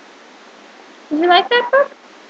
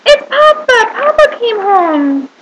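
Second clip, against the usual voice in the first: excited reveal; 6 semitones higher, slower than usual. Acted. Reading picture book to children, announcing Papa, excited. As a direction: make the kids cheer for Papa.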